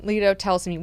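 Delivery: usual speaking voice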